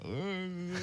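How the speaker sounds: pained groan at the light. Retold or imitated. Actor recalling the studio door, pained groan. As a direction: groan as if blinded by sudden sunlight. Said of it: "I actually was like".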